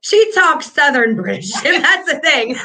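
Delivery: talking through giggles; a real laugh breaking into the words. Real, not acted.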